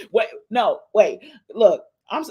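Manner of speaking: ordinary delivery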